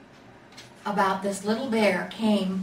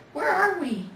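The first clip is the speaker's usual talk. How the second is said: much higher, small lost voice; little bear; 9 semitones higher, 3 dB louder than usual. A narrator's reading. Little bear asks the bear beside him, high and small. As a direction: ask it tiny and lost. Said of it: "he asked the bear".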